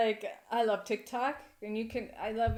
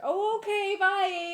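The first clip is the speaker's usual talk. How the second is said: much higher, loud squeak; mock startled visitor; 12 semitones higher, 7 dB louder, much slower than usual. The Jehovah's Witness replying to the witch, high and loud. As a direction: play the visitor backing off fast.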